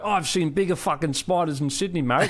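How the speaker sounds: lightly mocking Australian accent, pitch up; mock Australian commenter. Mocking online commenters who brag about Sydney spiders, in a light Australian accent. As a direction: sneer it like a smug Aussie commenter. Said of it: "lots of people are like".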